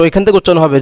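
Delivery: usual speaking voice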